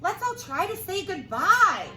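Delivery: high, loud invitation; cheerful villager. Acted. Man with a can urging the crowd, high and cheerful. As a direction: call everyone to say goodbye.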